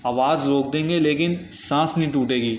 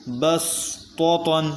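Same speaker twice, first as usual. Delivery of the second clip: very slow, drawn-out tajweed chant; Quran recitation; 2 semitones higher, much slower than usual. Shown as a demonstration. Teacher recites the next word for students, slow melodic chant. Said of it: "اگلا حرف ہے".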